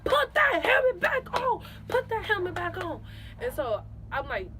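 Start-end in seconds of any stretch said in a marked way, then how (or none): 0.0-3.0 loud high yell at player; coach yelling. Retold or imitated. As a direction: bark the order like a coach. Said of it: "My coach was like".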